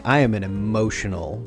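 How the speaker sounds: normal talking voice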